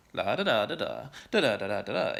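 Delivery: sing-song la da da da da, rising and falling like Swedish; Swedish sing-song imitation. Shown as a demonstration. Mimicking the Swedish rhythm for a friend, sing-song da da. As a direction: hum the tonal lilt of Swedish on da syllables. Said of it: "in its kind of tonal rhythm".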